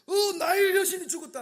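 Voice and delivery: high, loud alarmed shout; panicked cry. Acted. Egyptians crying that the Nile goddess is dead, panicked. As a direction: cry out in shock.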